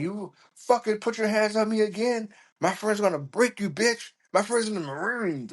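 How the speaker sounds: high-pitched mock belligerent threat; drunk friend's threat. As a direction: imitate a drunk friend picking a fight.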